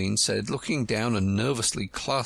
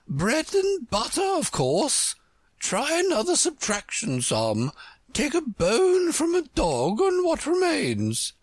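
high, forceful queen; bossy Red Queen; 3 dB louder than usual. Acted. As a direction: quiz the pupil in a very high, commanding voice.

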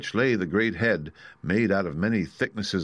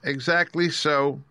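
pleased; 8 semitones higher, slower than usual. Acted. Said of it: "as if it pleased him".

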